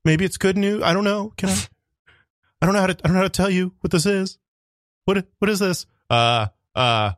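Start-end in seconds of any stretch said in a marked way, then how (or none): 0.0-5.9 higher, hesitant doctor voice; a nervous doctor breaking news; timid doctor. Acted. Timid doctor delivering a diagnosis. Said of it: "Timid doctor".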